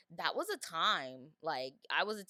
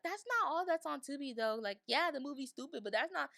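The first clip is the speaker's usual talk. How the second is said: protesting friend; 7 semitones higher than usual. Retold or imitated. Quoting friend defending Tubi.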